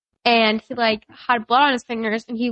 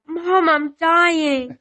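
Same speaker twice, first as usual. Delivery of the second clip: put-on slow, higher, wailing child's cry; imitating a crying child; 6 semitones higher, much slower than usual. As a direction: replay the kid sobbing that he's dying. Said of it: "you started crying, and then you went".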